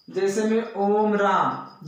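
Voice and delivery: ordinary delivery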